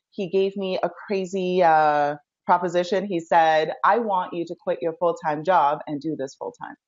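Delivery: usual speaking voice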